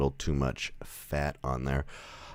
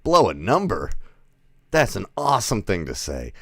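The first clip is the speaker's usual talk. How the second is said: loud, raised, swooping question; amused disbelief; 4 semitones higher, 6 dB louder than usual. Retold or imitated. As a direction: echo the phrase as if astonished by it. Own amused reaction to odd phrase.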